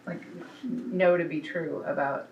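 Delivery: normal talking voice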